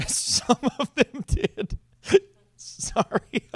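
strained, slowed choked-up words; real choking mid-sentence. Real, not acted. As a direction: get the words out through a choke. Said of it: "I think I'm choking on those words".

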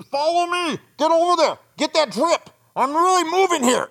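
high, loud drill-sergeant bark; barking orders. Retold or imitated. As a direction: bark orders at the kids like a foreman. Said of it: "I'm barking out".

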